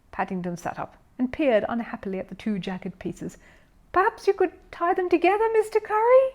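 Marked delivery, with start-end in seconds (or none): 3.9-6.3 much higher, timid hopeful question. A narrator's reading. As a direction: offer the idea hopefully. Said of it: "he said hopefully".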